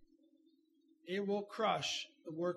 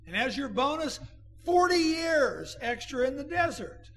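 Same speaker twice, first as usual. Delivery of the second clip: loud, raised preacher's cry; fiery pulpit delivery; full-force preaching; 5 semitones higher, 7 dB louder than usual. Acted. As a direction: drive it home to the congregation.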